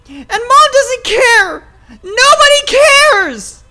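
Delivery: very high, loud, anguished outburst; hysterical screaming. Acted. Child pouring out hurt at father, hysterical scream. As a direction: scream the accusations, building to a wail.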